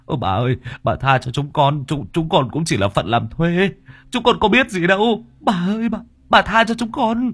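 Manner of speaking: frantic begging. A narrator's reading. Kneeling workers to the spirit, begging for their lives. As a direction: plead nonstop for mercy, voice rising into a wail. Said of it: "miệng không ngừng van xin".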